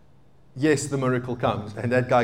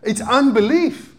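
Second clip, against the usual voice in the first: raised high, loud preacher's proclamation; preaching at full force; 10 semitones higher, 3 dB louder than usual. Acted. Preacher declaring to the congregation, raised and emphatic. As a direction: hammer home that it is unbelief.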